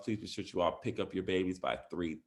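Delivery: ordinary delivery, nothing marked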